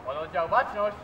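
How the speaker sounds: drill command. Real, not acted.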